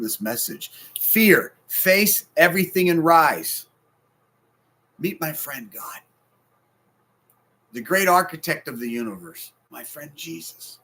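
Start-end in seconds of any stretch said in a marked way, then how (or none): 1.0-3.7 loud, slow, spelled-out slogan; proclaimed acronym. Real, not acted. 9.7-10.8 much softer, slowed down; tender hush. Real, not acted. Tender aside in sermon.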